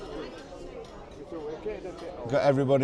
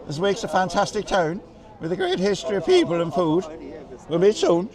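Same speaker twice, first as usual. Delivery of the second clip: hammed-up, unconvincing Cork accent; bad Cork accent; 3 semitones higher than usual. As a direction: have a clumsy go at a Cork lilt. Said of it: "that's my worst Cork accent ever".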